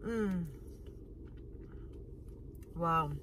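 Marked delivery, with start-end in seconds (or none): none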